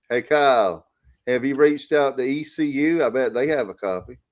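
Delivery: lightly put-on imitation of a buddy's accent; Stevie. Asking Kyle about a tape as the buddy, lightly imitated accent. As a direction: do a mild impression of a drawling friend. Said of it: "let me do my Stevie".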